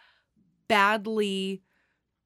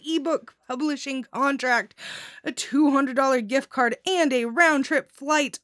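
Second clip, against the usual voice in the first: excited; 5 semitones higher, 5 dB louder than usual. Real, not acted. Announcing grand prize winner.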